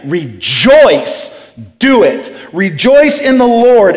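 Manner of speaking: firm, emphatic command. Retold or imitated. Preacher quoting Paul's order to the church, commanding. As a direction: issue it as an order. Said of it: "This is a command, a physical command".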